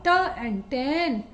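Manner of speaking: ट and tan said very slowly; slow phonic blending. Shown as a demonstration. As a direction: draw out ट into tan. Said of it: "T का ट".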